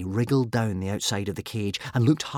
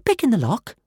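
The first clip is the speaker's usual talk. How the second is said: airy; 12 semitones higher, 4 dB louder than usual. A narrator's reading. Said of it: "replied Kamikaze airily".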